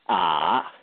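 "ah" dipping low, then rising; dipping Mandarin tone on "ah"; third tone. Shown as a demonstration. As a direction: sink deep on "ah" and swing back up. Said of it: "the third tone".

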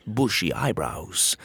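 ordinary delivery, nothing marked

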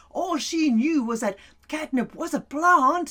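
ordinary delivery, nothing marked

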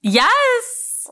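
high, loud burst of recognition; excited yes. Real, not acted. Cohost thrilled at recognizing the actor, loud high yes. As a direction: blurt it out thrilled.

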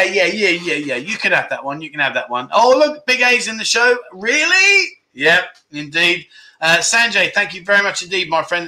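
ordinary talk

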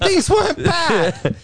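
high and slow, laughing through it; laughing slowly. Real, not acted. Repeating the joke for his co-host, slow and laughing hard. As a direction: stretch it out while cracking up.

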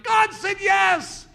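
slow, high, loud triumphant call; triumphant shout. Retold or imitated. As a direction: shout the good news slowly. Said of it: "the next person over is like".

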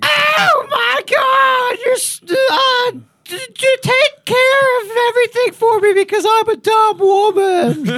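hammed-up shrieking diva, half crying. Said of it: "I have a [name] impression".

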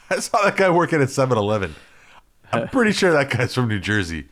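loud, high voice from the crowd; heckler calling out. Acted. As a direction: call out a mock accusation from the back. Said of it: "somebody stands up in the back".

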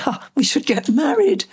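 high, playful mock exclamation; joking. Retelling a joke on first writing both names down, joking. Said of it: "jokingly said".

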